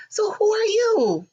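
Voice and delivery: high sing-song mock friendly; jolly question. Stepmother asks who the visitor is, sweet and high. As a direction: ask it sweetly. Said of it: "so nice and jolly".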